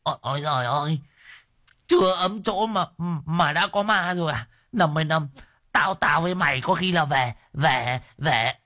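put-on high, panicky old man's wail; spooked, whimpering old helper; frightened yelp. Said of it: "Đồng già nó rú lên".

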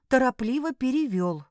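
usual speaking voice

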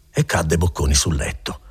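usual speaking voice

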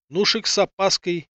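usual speaking voice

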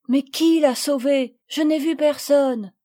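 put-on girl still worried about her friend; anxious questions. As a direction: press for an answer.

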